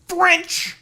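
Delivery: sudden high yell on French; explosive mock outburst; shouted punchline. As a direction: blurt it out as a loud, high punchline. Punchline about Montreal being French.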